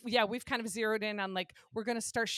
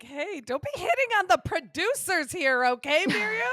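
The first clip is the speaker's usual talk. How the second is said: higher, louder playful scolding; mock scolding; 7 semitones higher, 8 dB louder than usual. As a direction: tease her like a stern host. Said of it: "Listen, not Miriam coming in hot".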